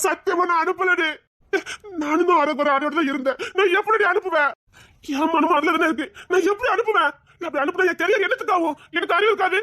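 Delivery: frantic, close-to-crying protest; desperate pleading. Acted. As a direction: beg her to believe him, voice cracking. He pleads innocence, near tears.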